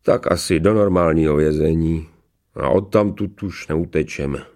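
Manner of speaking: low-key gloom in a put-on convict voice; rueful. A narrator's reading. Convict expects a prison with no escape, rueful. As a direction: resign yourself, with mild regret. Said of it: "pravil posmutněle".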